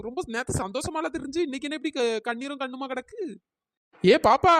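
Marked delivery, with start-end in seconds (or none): 4.0-4.5 put-on a loud call across to the girl; calling out loudly. Older woman loudly calling out to the crying girl. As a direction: shout to get her attention.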